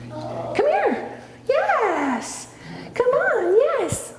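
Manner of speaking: very high sing-song call to the dog; high-pitched calling. Real, not acted.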